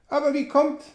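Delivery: high, cut-off question; a question broken off mid-word; startled question. A narrator's reading. Sam asks the narrator, startled and high. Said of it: "Sam cut off".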